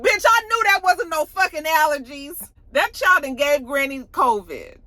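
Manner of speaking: high, loud, fed-up rant; loud high mock outrage; exasperated outburst. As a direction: she called it all along. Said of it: "she was just like".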